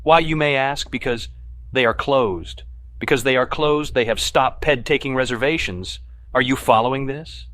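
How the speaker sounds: loud, high, hammering each point; exasperated rant. A narrator's reading. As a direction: lecture them like idiots, furious. McDermott ranting about closed restaurant.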